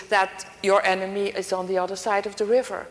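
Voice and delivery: ordinary delivery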